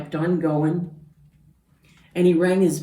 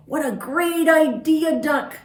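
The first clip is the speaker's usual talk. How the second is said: higher, louder delighted voices; much higher gleeful cheer; happy animals; 11 semitones higher, 5 dB louder than usual. Acted. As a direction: cheer it out together. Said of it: "they all said".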